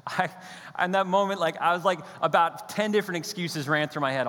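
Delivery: normal talking voice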